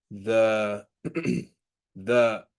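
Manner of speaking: stuttering and clearing throat; stumbling stutter on the word. Real, not acted. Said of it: "my stuttering is getting me".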